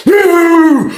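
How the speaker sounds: high, loud mock crowd "ooh"; hammed-up onlooker ooh. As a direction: imitate the crowd gasping ooh. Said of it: "that's why you hear noises like that".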